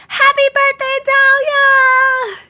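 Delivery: high, loud singsong "Happy birthday"; excited birthday greeting. Real, not acted. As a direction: belt it out like a party cheer.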